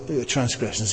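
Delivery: ordinary talk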